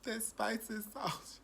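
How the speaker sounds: high, slow, drawn-out swoon. Real, not acted. Reviewer raving to viewers, high swoon. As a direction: melt over how good it is.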